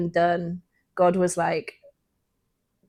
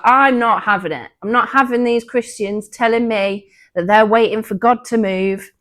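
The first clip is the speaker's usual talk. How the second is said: no-nonsense pastor; 4 semitones higher, 6 dB louder than usual. Retold or imitated. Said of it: "she was no nonsense".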